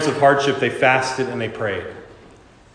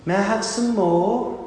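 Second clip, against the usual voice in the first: put-on high, slow, small pleading child voice; tiny orphan begging, voice pitched way up; timid little Oliver; 8 semitones higher, 3 dB quieter, slower than usual. Little Oliver asking the master for more, high and timid. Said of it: "and he goes".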